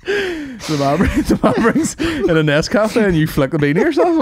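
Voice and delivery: high and laughing through the words; cracking up mid-sentence. Real, not acted. Laughing while joking to his mate.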